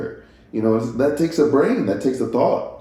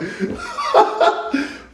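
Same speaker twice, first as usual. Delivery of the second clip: giddy high laugh. Real, not acted. Laughing at herself.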